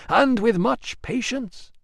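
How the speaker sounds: a solemn goddess calling out; commanding goddess voice. Acted. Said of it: "said the Shining Woman".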